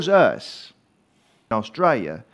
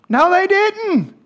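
raised, ringing pulpit delivery, driving each point home; full-force preaching; 8 semitones higher, 5 dB louder than usual. Acted. Preacher rousing his congregation about becoming Australian, full-force preaching. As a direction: preach it hard to the back pew.

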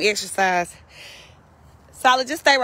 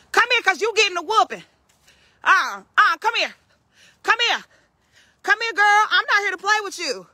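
high, firm telling-off; high stern scold; 7 semitones higher than usual. Real, not acted. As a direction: warn her she's getting a whooping. Scolding the caught pig.

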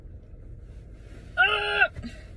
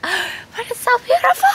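awed gasp; 10 dB louder than usual. Real, not acted. Gasping at the view.

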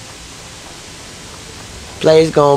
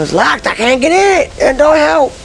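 usual speaking voice

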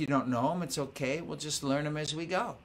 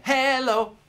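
loud call; 9 semitones higher, 9 dB louder than usual. Shown as a demonstration. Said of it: "I'll sing 'em".

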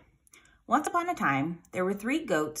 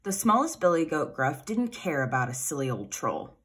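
plain reading voice

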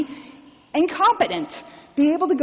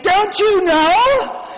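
higher, louder, slow and indignant; slow, raised, insulted protest; angry retort; 5 semitones higher, 4 dB louder, slower than usual. Retold or imitated. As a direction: spit it out, offended anyone would offer. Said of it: "I'm getting angry at this point".

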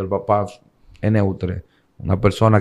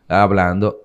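normal talking voice

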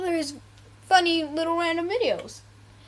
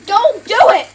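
loud yell; 6 semitones higher, 12 dB louder than usual. Real, not acted. Yelling at teammate in game.

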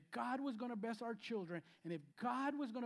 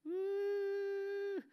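high, drawn-out teasing ooh; 12 semitones higher, 5 dB louder than usual. Retold or imitated. Son teasing his arguing parents, high mocking ooh. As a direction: tease the parents like a cheeky son. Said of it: "Caleb would always go".

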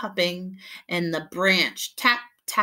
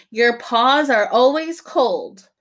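louder, slow grumble; grumpy complaint; 4 semitones higher, 8 dB louder than usual. Acted. Big mouse grumbling at little mouse, louder and slow. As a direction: complain with a huff.

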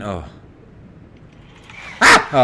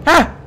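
high, loud startled yelp; startled scream; 5 dB louder than usual. Real, not acted. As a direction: yelp as it jumps out. Another jump scare.

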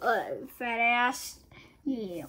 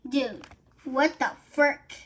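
ordinary delivery

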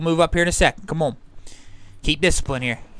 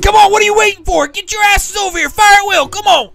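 full-throated, much higher furious shout; yelling; 10 dB louder than usual. Real, not acted. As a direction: bellow at the stragglers to hurry. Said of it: "once I started yelling at him".

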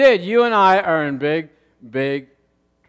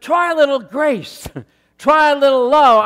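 playful advice; 4 semitones higher, 5 dB louder than usual. Retold or imitated. Pastor's advice in retold talk.

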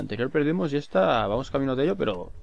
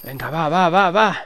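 rising, louder cheering on; urgent urging; 4 semitones higher, 6 dB louder, slower than usual. Real, not acted. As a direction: push them on with growing urgency. Urging during final push.

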